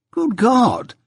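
high-pitched despairing cry; desolate desperation. A narrator's reading. Mister Pocket despairing of his wife, desperate outburst. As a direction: exclaim at wit's end. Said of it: "cried Mister Pocket in an outbreak of desolate desperation".

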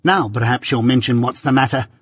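plain reading voice

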